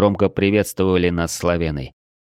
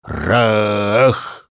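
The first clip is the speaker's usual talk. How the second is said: forceful breathed-out battle shout; massed war cry. A narrator's reading. Hundreds of warriors answer as one, war cry. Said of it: "Разом выдохнули сотни глоток".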